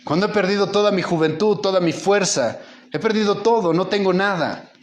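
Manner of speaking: high, pleading cry of a man who has lost everything; anguished dying father. Retold or imitated. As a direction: plead like a broken old man facing death. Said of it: "y dice".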